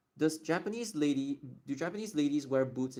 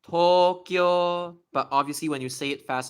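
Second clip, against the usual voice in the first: usual speaking voice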